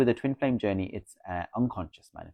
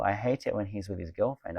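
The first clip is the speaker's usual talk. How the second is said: normal talking voice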